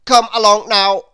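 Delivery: loud, high and brisk; Mister Bustle urging Lazy up, loud and busy. A narrator's reading. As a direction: hurry him along impatiently. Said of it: "said Mister Bustle busily".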